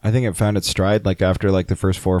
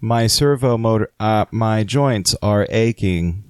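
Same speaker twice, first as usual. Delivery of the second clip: robot voice; slower than usual. Pretend. Robot pretending to be human.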